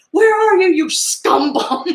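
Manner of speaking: high, loud, slowed mock yell with a laugh; laughing impression of a furious sheriff; sheriff's yell. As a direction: bellow it like the angry sheriff. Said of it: "I like the phrase scum bum".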